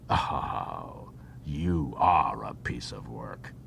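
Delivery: low, breathy aside of disbelief; exasperated whisper. A narrator's reading. Odo whispering in exasperation at Vash, low and slow. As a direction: mutter it under your breath, slow and low.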